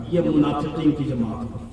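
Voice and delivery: low, soft aside from the pulpit; hushed, low-pitched remark; subdued statement. Real, not acted. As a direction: say it quietly, with the voice dropped. First take, dropped low.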